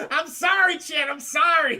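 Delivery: high, loud, worked-up apology. Real, not acted. Streamer apologizing to chat after cracking up, high and loud. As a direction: blurt the apology over your amusement.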